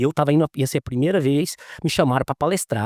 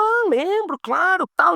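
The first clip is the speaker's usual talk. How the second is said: high, bright burst of recognition; eager recognition; 13 semitones higher, slower than usual. Retold or imitated. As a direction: judge delighted to be remembered. Judge eagerly recalls the course.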